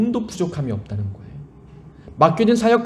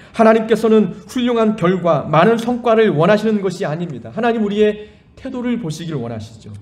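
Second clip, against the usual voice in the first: louder, higher exhortation; preaching at full force; 4 semitones higher, 4 dB louder than usual. Acted. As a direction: urge the congregation with fervor.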